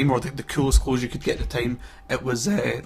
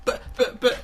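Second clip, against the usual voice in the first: higher, spluttered mock protest; stammered protest; 11 semitones higher than usual. Acting the kid with the worst present, stammered protest. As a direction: imitate a disappointed kid stuttering an objection. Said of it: "and then he's like".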